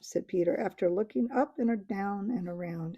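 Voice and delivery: ordinary delivery, nothing marked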